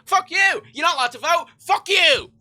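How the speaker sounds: high, loud outburst of outrage; angry mock refusal. Acts out hostile refusal to voters.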